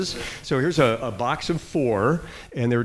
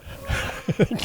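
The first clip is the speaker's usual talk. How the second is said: breathy embarrassed laugh; 10 semitones higher than usual. Real, not acted. Punchline about the wrong seats.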